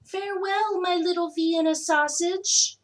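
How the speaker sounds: high, loud doting call; sweet high farewell called from the front yard. A narrator's reading. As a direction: call it out across the yard like a proud mum. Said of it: "waving and calling out".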